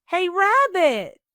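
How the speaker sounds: high, loud call drawn out slowly; loud slow yell at the door. A narrator's reading. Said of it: "someone yelled from outside".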